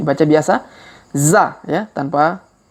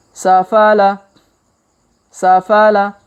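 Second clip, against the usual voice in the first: faster reading; 4 semitones higher, 4 dB louder, much slower than usual. Shown as a demonstration. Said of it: "Kalau dipercepat".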